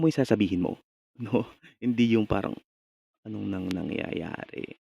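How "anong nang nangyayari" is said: slurred lazy reading. Shown as a demonstration. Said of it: "Avoid lazy mouth or slurring of words".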